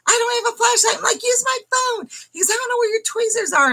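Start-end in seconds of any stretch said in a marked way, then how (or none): 0.1-1.0 high flustered protest; re-enacted frantic whine; imitated panic. Retold panicked exchange with husband. 1.2-2.1 high-pitched frantic reply; retold screaming. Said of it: "And I'm screaming". 2.5-3.7 high, fast flustered protest; rushed, high-pitched mock panic; imitated panic. Retold panicked exchange with husband.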